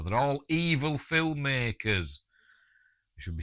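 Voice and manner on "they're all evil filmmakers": mock outrage. Jokey outrage over killed scorpion.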